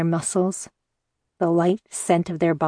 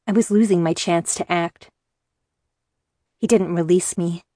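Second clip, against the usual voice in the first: ordinary delivery, nothing marked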